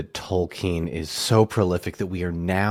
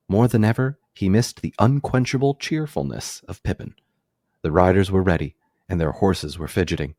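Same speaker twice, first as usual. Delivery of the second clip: dramatic reading aloud; 6 dB louder than usual. Acted. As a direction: read it like an audiobook. Reads a book passage aloud.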